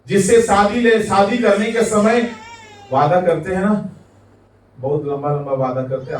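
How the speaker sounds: normal talking voice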